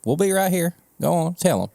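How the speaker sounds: playful coax. Pretend. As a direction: nudge them like shooing a kid along. Coaxing listeners toward camera.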